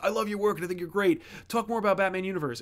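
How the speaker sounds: lightly hammed-up fan flattery; gushing fan. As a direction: rattle it off like an eager fan buttering him up. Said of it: "as opposed to saying, like".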